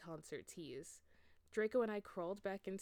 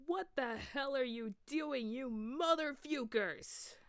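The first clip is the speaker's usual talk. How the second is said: high, loud, slow furious roar; furious yell; 7 semitones higher, 5 dB louder, slower than usual. A narrator's reading. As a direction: bellow it as you catch them. Dumbledore bursts in.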